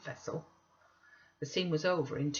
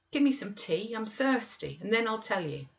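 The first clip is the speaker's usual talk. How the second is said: put-on higher, petulant sickly boy; whiny Linton; 6 semitones higher than usual. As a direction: demand tea like a spoiled invalid. Sickly Linton demands tea.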